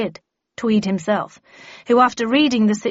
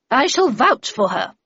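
higher, moderately raised voice; thunderous declaration; 6 semitones higher, 5 dB louder than usual. A narrator's reading. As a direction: declare it with imperious force. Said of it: "in a thunderous tone".

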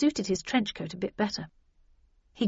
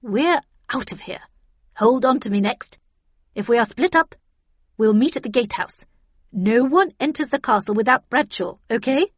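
loud, raised commanding voice; Havisham in command; 3 semitones higher, 7 dB louder than usual. Acted. Havisham instructing the team before the jump, loud and commanding. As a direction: give firm orders to the team.

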